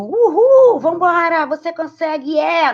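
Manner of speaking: high, loud pep cheer; hyped cheering. Shown as a demonstration. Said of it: "Não, não é isso não que eu tô dizendo". (torcida empolgada, aguda e alta)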